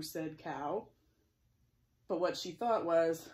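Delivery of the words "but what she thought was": usual speaking voice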